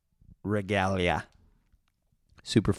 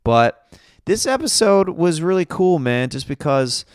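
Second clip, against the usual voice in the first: excited; 8 semitones higher, 6 dB louder than usual. Real, not acted. Excited about upcoming episode.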